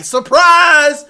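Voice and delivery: very loud, high, drawn-out cry; spring it on them at full volume; gleeful villain shout. Acted. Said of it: "he's just laughing".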